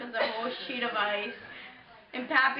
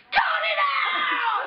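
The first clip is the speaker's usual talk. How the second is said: high, loud, drawn-out mock shout; imitated angry holler; mad grandfather; 13 semitones higher, 5 dB louder, much slower than usual. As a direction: yell it slowly like a fed-up grandfather. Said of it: "Pappy was so mad".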